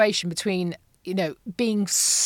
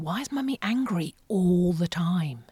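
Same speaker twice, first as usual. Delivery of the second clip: put-on quiet childlike whisper; 3 semitones lower, 5 dB quieter than usual. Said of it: "hearing my daughter say".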